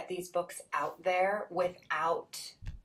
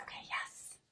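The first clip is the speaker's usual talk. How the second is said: whisper; 10 dB quieter than usual. Real, not acted. Stunned by kids' praise.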